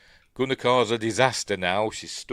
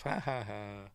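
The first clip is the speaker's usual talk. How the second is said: soft, half-hearted ha ha ha; mock laugh; 9 dB quieter than usual. Recalling the audience after the jump scare, dry mock laugh. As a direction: read out a token laugh, softly. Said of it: "we all laugh".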